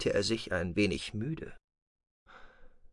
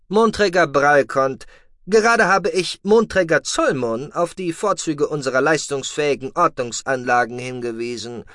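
higher, louder voice of a dry, lecturing commander; a calm officer scolding his deputy; stern commander; 6 semitones higher, 5 dB louder than usual. Acted. Commander dryly lecturing his complaining deputy, raised and higher.

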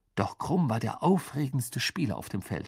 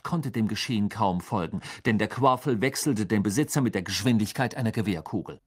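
ordinary delivery, nothing marked

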